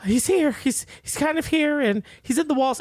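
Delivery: high, frantic, haunted whisper-talk; Winona Ryder impression. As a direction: pitch it up like a panicked mother hearing ghosts. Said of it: "she's like".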